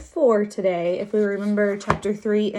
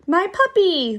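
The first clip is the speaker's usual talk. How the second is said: put-on high child's voice; high-pitched toddler exclamation; little kid; 10 semitones higher, 4 dB louder than usual. A narrator's reading. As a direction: claim it like a possessive small child. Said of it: "the kid said".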